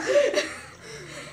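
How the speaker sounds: high airy giggle; breathless laugh. Real, not acted. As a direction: burst out giggling. Laughing at scolding.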